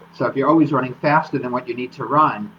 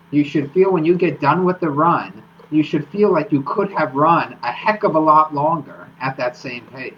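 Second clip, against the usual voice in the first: ordinary delivery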